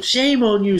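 high, loud telling-off; acted outraged reprimand; scolding. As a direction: scold loudly, pitched right up. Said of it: "You could scold someone".

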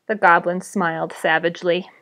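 ordinary delivery, nothing marked

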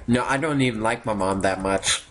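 ordinary talk, nothing marked

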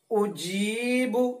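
उजीबु with the ई held long and slow; long stretched vowel. Shown as a demonstration. Teacher showing students the long ई of उजीबु. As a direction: stretch the ई in उजीबु for one alif. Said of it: "एक अलिफ के बराबर खींच कर पढ़ेंगे".

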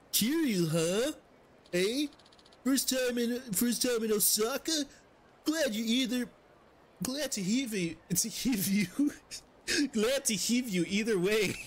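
fumbling fake Swedish NPC voice; half-hearted Swedish accent drifting toward generic European; attempted Swedish accent. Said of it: "It's just turning into a Swedish guy".

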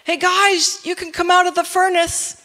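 high, loud, casual call; Nebuchadnezzar calling down. Acted. King calling men out of the furnace, high calling voice. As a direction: call down to them from above. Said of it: "Nebuchadnezzar, he, he looks down".

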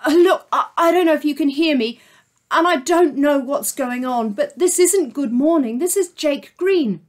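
high, loud desperate plea; urgent. Acted. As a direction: plead quickly before time runs out. Jake desperate on phone.

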